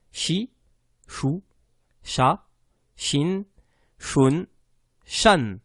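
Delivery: each Shin syllable said separately and clearly; syllable drill. Shown as a demonstration. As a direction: drill shi chou cha shin shun shan one by one. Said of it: "il n'y a rien de compliqué". (exercice de syllabes, détachées une par une)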